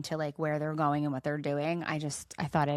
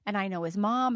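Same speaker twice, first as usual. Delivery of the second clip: ordinary delivery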